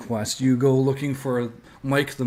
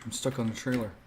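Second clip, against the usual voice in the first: normal talking voice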